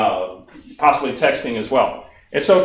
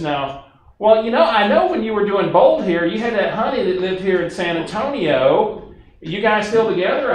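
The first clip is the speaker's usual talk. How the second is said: higher, nosy friendly caller; Barbara's voice; 6 semitones higher than usual. Retold or imitated. Barbara asks Steve about his San Antonio honey, higher voice. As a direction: lift the voice, teasing and curious. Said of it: "I'm gonna talk like Barbara for a minute".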